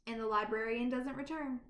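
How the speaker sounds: ordinary delivery, nothing marked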